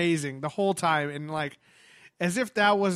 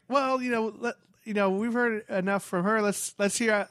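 panel host; 6 semitones higher than usual. Retold or imitated. Acted host introducing surprise guest.

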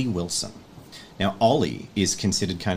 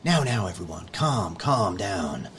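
calm leader; 4 dB quieter than usual. Acted. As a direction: settle a panicking room like a trusted mentor. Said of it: "he's just like".

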